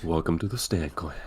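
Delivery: soft, low, sultry whisper; sexy ASMR voice. Pretend. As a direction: purr it like a sexy ASMR host. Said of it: "my sexy voice".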